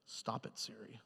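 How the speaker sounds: whispered aside to the phone; low hissed stop it to Siri; muttered aside. Real, not acted. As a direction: hush the phone under your breath. Phone going off.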